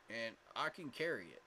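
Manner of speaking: ordinary talk, nothing marked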